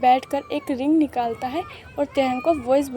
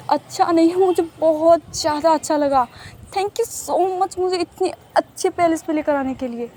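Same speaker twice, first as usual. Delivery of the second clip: higher, eager gushing; excited thanks; 4 semitones higher than usual. Acted. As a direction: gush with delight. Taehyung thrilled, thanking Jungkook. (ऊँची आवाज़ में खुशी से धन्यवाद)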